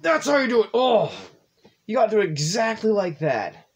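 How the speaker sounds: loud, high victory yell; triumphant shout. Real, not acted. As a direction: celebrate the win out loud. Triumphant shout after success.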